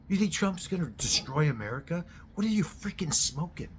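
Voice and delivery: incredulous mockery. Real, not acted. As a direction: scoff at the absurd idea.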